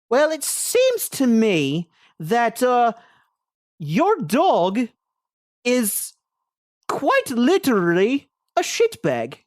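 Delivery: put-on high, slow pompous drunk bard voice. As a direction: savour each word like a tipsy showman. Drunk bard NPC speaks.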